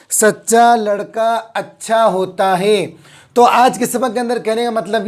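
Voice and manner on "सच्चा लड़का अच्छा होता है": सच्चा लड़का अच्छा होता है read slowly, each word distinct, with सच्चा held on the doubled च; slow model reading. Shown as a demonstration. Teacher reading a sample sentence slowly for students to repeat. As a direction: read it slowly for the class to copy. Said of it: "क्या पढ़ेंगे इसको".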